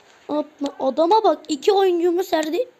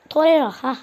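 ordinary talk, nothing marked

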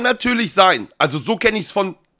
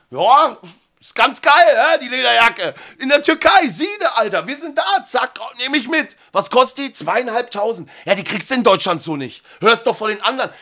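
hammed-up salesman; 7 semitones higher than usual. Seller hyping the jacket.

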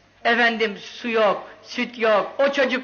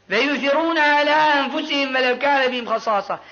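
Quran recitation. Acted.

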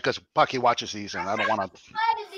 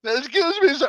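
put-on lightly squeaky, higher boyish voice; a hint of a cartoon schoolboy, pitched up; cartoon kid voice; 10 semitones higher than usual. Apologizing after his voice cracks, lightly mock cartoon kid. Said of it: "I sound like the kid from The Simpsons".

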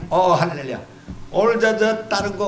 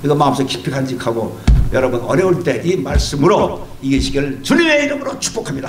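usual speaking voice

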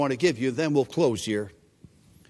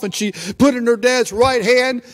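ordinary talk, nothing marked

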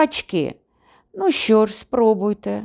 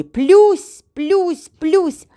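high, loud splash imitations; splash noises; 10 semitones higher, 5 dB louder, much slower than usual. A narrator's reading. Narrator imitates the hunter slapping the water, high splashes. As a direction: mimic hands slapping the water.

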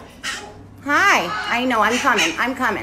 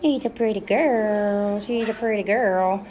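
tender cooing; 3 dB quieter than usual. Real, not acted. Cooing over a pet bird.

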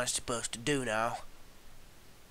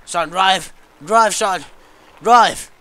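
higher and louder each time; urgent yelling; 6 semitones higher, 12 dB louder, slower than usual. Real, not acted. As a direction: push him to drive faster. Urging driver in game.

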